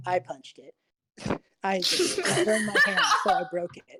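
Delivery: a voice leaning right up to the stone, laughing through its confession; close-up confession. Acted. Voice close to the stone, laughing confession. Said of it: "get really close up to the other voice and just go".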